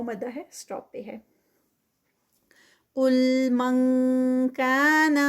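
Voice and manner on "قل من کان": slow, drawn-out tajweed recitation; Quranic recitation. Shown as a demonstration. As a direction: chant it slowly, stretching each sound. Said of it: "قل، قاف heavy". (آہستہ کھینچ کر تلاوت)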